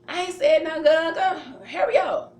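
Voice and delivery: loud, high, laughing "hurry up"; sister hollering back. Retold or imitated. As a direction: sister shouting from across the room that she said nothing. Sister yells back at her.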